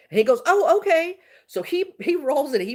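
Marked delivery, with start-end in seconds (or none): 0.5-1.2 high, loud, perky imitation; brightened up. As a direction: imitate him suddenly cheering up. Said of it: "So he brightens up again".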